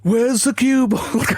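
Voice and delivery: loud, slow, high shout into the mic; shouted Virgo impression. Doing Virgo on request, loud slow shout. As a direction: bellow it like a startled commentator. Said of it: "I was shouting down the mic".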